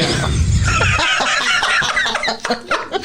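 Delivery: high squealing burst of laughter; cracking up hard at the joke; big laugh. Real, not acted. Friend teased about his family, bursting into high laughter. Loud laugh after teasing.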